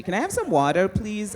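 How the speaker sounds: mock American accent asking for water. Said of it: "stop doing an American accent".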